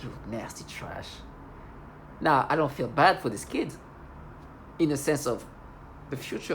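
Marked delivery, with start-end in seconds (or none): 0.0-1.1 much lower, much softer, slow; telling her neighbor off, low slow mutter; muttered insult. Retold or imitated. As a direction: mutter it under your breath.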